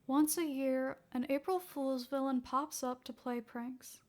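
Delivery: ordinary delivery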